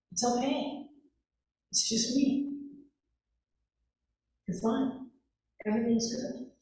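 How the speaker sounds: soft, hushed, calming the frightened men; hushed comforting voice; gentle reassurance. Retold or imitated. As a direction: soothe them quietly, like calming a scared child. Jesus calming frightened disciples.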